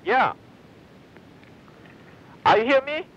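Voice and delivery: ordinary talk, nothing marked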